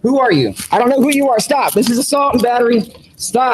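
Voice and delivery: rising panicked cries of stop; panicked pleading. Real, not acted. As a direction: beg them to stop in alarm. Man being arrested, panicking.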